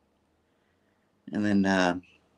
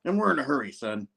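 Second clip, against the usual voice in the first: higher, put-on bossy voice fading off; gruff Jonah impression; 9 semitones higher than usual. Jonah orders a sandwich from the baker, in a bossy put-on voice. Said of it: "he's like".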